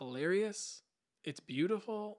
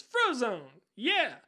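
character's shout; 6 semitones higher, 9 dB louder, much slower than usual. Retold or imitated. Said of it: "And then he goes".

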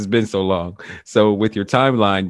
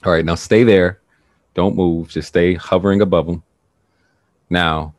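guided meditation; 2 semitones lower, 3 dB louder than usual. Acted. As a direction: guide them gently through the past. Leads a guided regression meditation.

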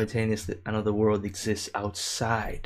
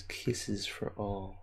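soft reading; 9 dB quieter, much slower than usual. Retold or imitated. Reading a viewer's comment.

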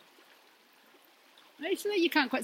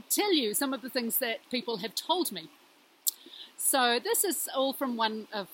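usual speaking voice

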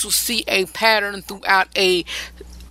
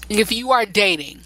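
normal talking voice

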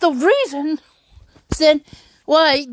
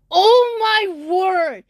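shocked; 6 semitones higher, much slower than usual. Retold or imitated. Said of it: "I'm like".

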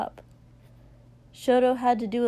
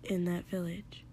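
barely voiced whisper; 3 semitones lower, 10 dB quieter than usual. A narrator's reading. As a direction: whisper it under the breath. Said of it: "Shoto said quietly".

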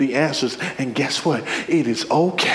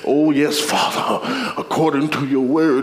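hammed-up holy-roller prayer; mock pious praying, slowing into a shouted rebuke; real holy; 3 semitones lower than usual. Preacher parodies holy believers rebuking the enemy, real holy. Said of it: "many of us will be real holy".

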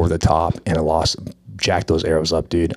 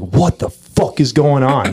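higher, slower, exasperated yell; mock frustrated outburst; 7 semitones higher, slower than usual. Archer retelling his missed shot, hamming up his angry outburst. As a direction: act out losing it over the missed shot.

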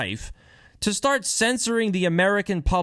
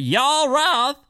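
Yoel Roth, much higher and louder, in an exaggerated country twang; hammed-up country accent; 12 semitones higher, 5 dB louder than usual. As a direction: belt out Yoel Roth like a mocking country boy. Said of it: "I should say that with a country accent".

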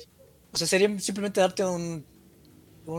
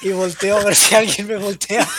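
normal talking voice

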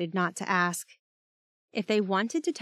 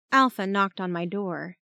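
plain reading voice

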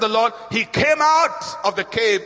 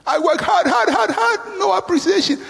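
unappreciated complaining; 3 semitones higher than usual. Acted. Unappreciated person in a cave listing chores, complaining. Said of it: "When you start talking like that".

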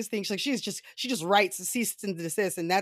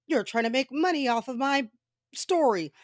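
higher-pitched imitation of an angry accuser; hammed-up indignation, pitched up; mock angry; 7 semitones higher, 3 dB louder than usual. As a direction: accuse indignantly, putting on her anger. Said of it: "she's angry".

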